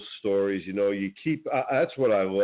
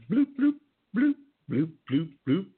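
high bloop bloop bubble noises; bubble sounds; 10 semitones higher than usual. Pretend. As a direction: burble like bubbles rising. Imitating bubbles underwater.

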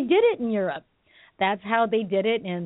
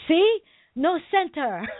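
hammed-up sifu proving a point; mock teacher scolding; 7 semitones higher, slower than usual. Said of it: "she's like".

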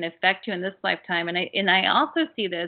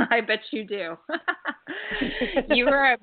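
said through a knowing chuckle; amused; 6 semitones higher than usual. Real, not acted. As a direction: laugh along as you say it. Knowing, amused reply to caller.

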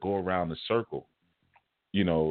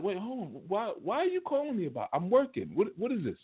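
high, soft, puzzled questioning; incredulous; 11 semitones higher, 4 dB quieter than usual. Retold or imitated. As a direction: act baffled by a pointless call. Incredulous reply to friend's call.

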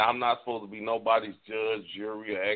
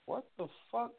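shocked disbelief; 12 semitones higher, 10 dB quieter than usual. Retold or imitated. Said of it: "And I'm like".

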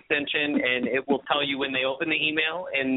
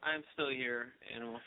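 faint, far from the mic; barely there, distant voice; barely audible; 3 semitones lower, 13 dB quieter than usual. Real, not acted. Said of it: "you sound very faint".

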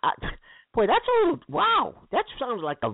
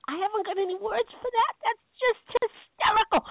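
high, breaking up with amusement; helplessly amused, pitched way up; cracking up; 12 semitones higher than usual. Real, not acted. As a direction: squeal it out, overcome with hilarity. Host cracking up at duck voice.

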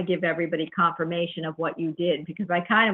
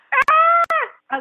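frustrated yelp; 6 dB louder than usual. Real, not acted. Host frustrated by broken audio.

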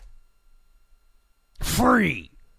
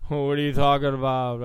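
a hint of [name], low and fast; failed Springsteen impression; 6 semitones lower, faster than usual. Host giving up on mimicking [name], low and fast. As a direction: mumble a half-hearted [name] imitation. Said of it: "I don't even know how to do a [name] accent".

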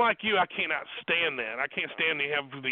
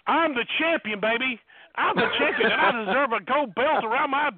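higher, strutting brag; mock boast; 5 semitones higher than usual. Boasting to the hosts about battle royals, higher and cocky. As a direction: crow about being champion.

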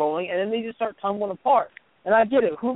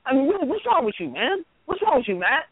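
higher-pitched, incredulous plea; exasperated questioning; 6 semitones higher than usual. Acted. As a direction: demand to know what's wrong, pitch jumping up. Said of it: "I'm looking at Matt Ryan and going".

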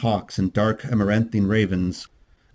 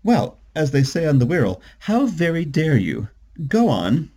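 higher, mock-outraged woman's voice; animated, higher-voiced comeback; indignant defender; 7 semitones higher, 3 dB louder than usual. A narrator's reading. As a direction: leap to his defence, pitched up. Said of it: "Cynthia flew to John's defense".

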